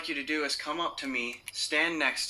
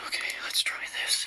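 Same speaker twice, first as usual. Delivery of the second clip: whisper. Real, not acted. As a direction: keep it to a breath. Whispering during ghost hunt.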